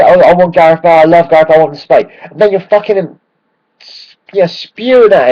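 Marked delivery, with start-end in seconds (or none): 0.0-2.2 mocking fickle fan. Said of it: "You say".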